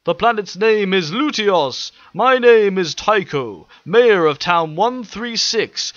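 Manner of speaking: formal, careful welcome to strangers; polite alien mayor. Acted. Mayor Tycho welcoming the machines, formal high slow voice.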